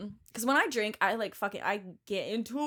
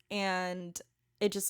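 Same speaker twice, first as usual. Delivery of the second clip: ordinary delivery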